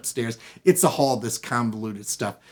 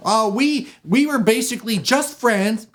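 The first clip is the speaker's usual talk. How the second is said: high, loud protesting voice; nervous little man; 10 semitones higher, 6 dB louder than usual. Acted. Little man explaining himself to the husband, nervous. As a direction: protest innocence, pitched way up.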